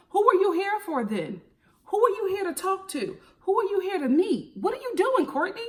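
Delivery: high, rising exasperated questions; exasperated questioning. Real, not acted. Vlogger grilling Courtney through the screen, high and exasperated.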